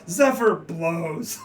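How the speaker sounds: loud, high, drawn-out announcement; mock captain's call. Game master as a captain making a pun, mock bellow. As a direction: call it out long and loud for a joke. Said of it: "Captain goes".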